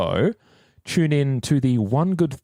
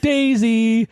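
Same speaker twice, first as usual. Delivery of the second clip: drawn-out answer; 9 semitones higher, 4 dB louder than usual. Pretend.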